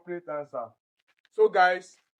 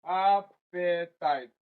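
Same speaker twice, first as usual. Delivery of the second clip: "Appetite" slowly split, AP-pe-tite; stress on first syllable; much slower than usual. Shown as a demonstration. Teacher spells out option D by sound, slow first-syllable stress. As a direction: draw out "Appetite" in syllables, AP leading. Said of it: "App is very stressed".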